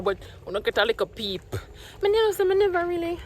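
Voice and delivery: ordinary delivery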